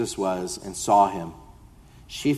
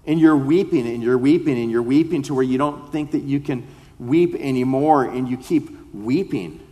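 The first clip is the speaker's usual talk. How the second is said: rising preaching fervor; 2 semitones higher, 3 dB louder than usual. Acted. Preaching builds on weeping.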